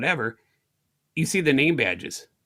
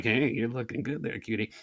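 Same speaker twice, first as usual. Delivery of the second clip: low, soft, creepy come-on; a hint of a sleazy admirer murmuring; creepy flirt; 3 semitones lower, 6 dB quieter than usual. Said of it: "Not creepy, like".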